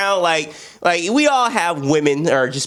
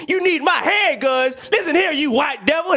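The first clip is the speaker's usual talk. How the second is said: higher, slow, heated imitation; mock angry rapper; 13 semitones higher than usual. [name] confronting the gun takers, mock anger.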